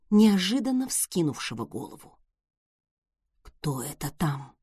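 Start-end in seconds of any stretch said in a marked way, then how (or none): 3.4-4.5 low, slow, tense whisper; alarmed whisper. A narrator's reading. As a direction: hiss a frightened question. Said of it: "тревожный громкий шёпот Колина".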